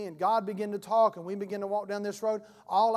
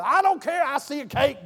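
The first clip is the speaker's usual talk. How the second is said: loud and higher, brushing the objection aside; ecstatic prophet; 4 semitones higher, 9 dB louder than usual. Acted. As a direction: insist on the vision with a shout. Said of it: "The prophetic is like".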